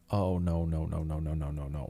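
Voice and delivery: low, hushed run of no-no-no; mock dread, muttered softly and trailing away; retold dismayed screaming. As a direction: recount a TV scream as a quiet, sinking chant. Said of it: "screaming at the T V going".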